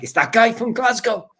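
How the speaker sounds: high, loud indignant fan voice; mock angry German fan. Imagining a German Europe fan recognising him, high mock outrage. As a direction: shout it like a wronged fan spotting him. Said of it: "German Europe fans that are like".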